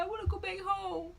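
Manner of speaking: high, whiny mock sobbing; re-enacted crying plea; imitated crying. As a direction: whine it like a tearful child. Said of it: "I burst out and started crying".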